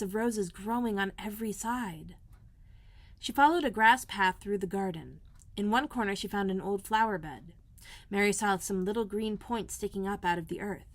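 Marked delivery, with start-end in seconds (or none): none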